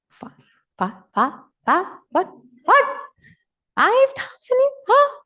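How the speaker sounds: can't believe the number, stutter then squeal; stunned disbelief. Retold or imitated. Speaker retelling hearing five thousand, stammering in shocked disbelief.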